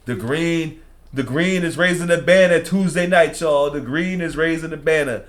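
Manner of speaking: loud, high hype; excited. Real, not acted. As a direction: hype it up for the team. Hyped about team's championship banner.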